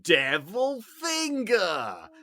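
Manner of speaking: slow, high, drawn-out Devil Finger; shouted catchphrase. Retold or imitated. Retelling the kid's sketch line, drawn-out high shout. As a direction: stretch out each word, loud and high. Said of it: "he would always say it with that inflection".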